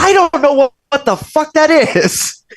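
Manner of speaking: high-pitched, exasperated outburst, stretched out at the end; exasperated retort. Retold or imitated. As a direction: retell it in shrieky exasperation. Retold exasperated reply to mother.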